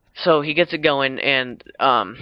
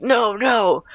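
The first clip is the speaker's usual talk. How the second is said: high, louder retold "no, no"; mock alarmed cry; 9 semitones higher, 5 dB louder than usual. Retelling the guy seeing his friend roll off, mock alarmed cry. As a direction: cry out in imitated alarm, pitched well up.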